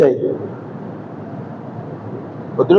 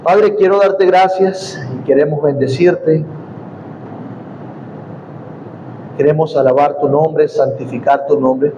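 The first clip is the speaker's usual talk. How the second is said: prayer; 4 semitones lower than usual. Real, not acted. Leads congregation in prayer.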